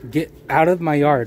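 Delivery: loud firm yell; angry shout. Real, not acted. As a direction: order him off the yard, loud. Homeowner ordering trespasser out.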